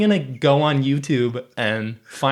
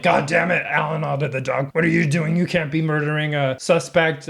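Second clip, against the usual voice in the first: higher-pitched mock reprimand; scolding police chief; 4 semitones higher than usual. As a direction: chew out a subordinate as the exasperated chief.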